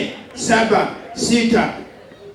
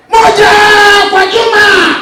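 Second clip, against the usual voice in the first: high, loud drill count; drill shout; 8 semitones higher, 5 dB louder, slower than usual. Acted. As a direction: yell the count at recruits.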